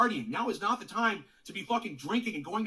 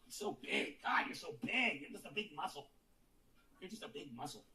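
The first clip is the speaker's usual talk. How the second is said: cooing at dog; 4 semitones lower, 9 dB quieter than usual. Real, not acted. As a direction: coo over the dog, almost breathless.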